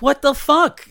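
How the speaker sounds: high, loud retold disbelief; shocked outburst. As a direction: yelp it in mock shock. Retold shock at shooter news.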